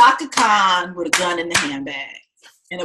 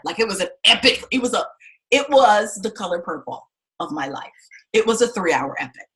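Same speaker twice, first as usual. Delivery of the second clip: usual speaking voice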